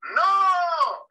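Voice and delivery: a high, loud group shout of no; children yelling no at the pastor; kids shouting no. Retold or imitated. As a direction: shout it back like a room of kids. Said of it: "Gritaron todos que no".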